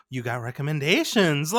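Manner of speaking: hammed-up amazed career coach; mock astonishment. Acted reviewer gasps at the news.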